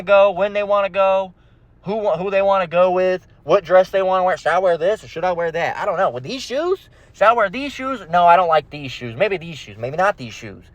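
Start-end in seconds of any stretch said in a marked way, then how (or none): 4.4-10.6 mock dithering, questions lilting upward; indecisive woman. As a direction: dither over an outfit in imitation. Imitates women dithering over outfits.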